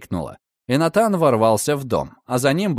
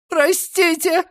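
very high frightened cry; slower than usual. A narrator's reading. Girl struggling in his grip, very high frightened cry. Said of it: "крикнула она".

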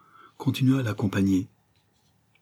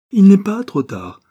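high, loud, drawn-out protest; impassioned cry; 12 semitones higher, 11 dB louder than usual. A narrator's reading. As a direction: cry it out slowly, pitched right up. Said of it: "s'écria-t-elle". (cri passionné)

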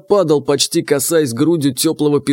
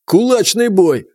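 higher, louder, slower call; commanding shout; 5 semitones higher, 3 dB louder than usual. A narrator's reading. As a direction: announce the fight like a sergeant. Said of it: "скомандовал Фома". (громкая команда сержанта)

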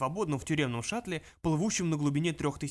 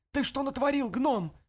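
angry shout; 10 semitones higher, 4 dB louder than usual. Acted. Dubets grabs Mulch angrily.

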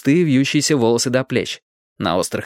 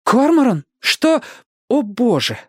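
alarmed; 13 semitones higher, 3 dB louder, slower than usual. Acted. Robin sees the struggle.

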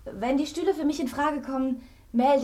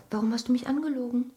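usual speaking voice

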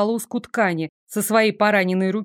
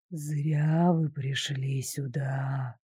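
low, slow, hissing ghost voice; ghost whisper; 8 semitones lower, 9 dB quieter, much slower than usual. A narrator's reading. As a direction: hiss it like a hateful spirit. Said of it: "этот тихий шёпот".

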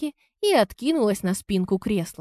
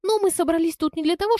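high-pitched, brisk rebuke in a character voice; indignant rebuke; 9 semitones higher, faster than usual. A narrator's reading. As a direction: cut off the speculation, voice raised in pitch. Heroine snapping in a business quarrel.

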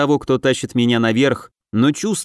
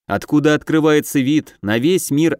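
ordinary delivery

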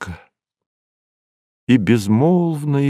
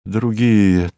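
usual speaking voice

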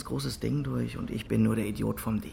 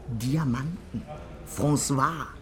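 ordinary talk

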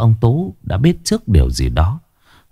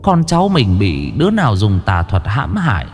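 put-on old woman muttering; 4 semitones higher than usual. A narrator's reading. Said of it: "lẩm bẩm".

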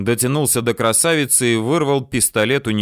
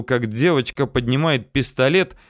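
plain reading voice

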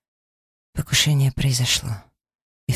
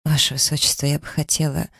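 plain reading voice

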